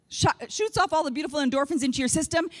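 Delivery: ordinary talk, nothing marked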